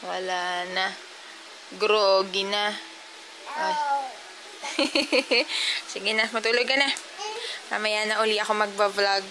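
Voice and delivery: put-on high, loud sing-song baby talk to a toddler. Mom coaxing her sleepy toddler to bed, in high baby talk. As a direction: coo at the little one like a doting mom.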